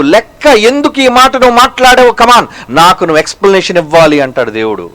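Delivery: ordinary delivery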